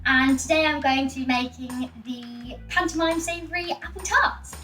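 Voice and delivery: ordinary talk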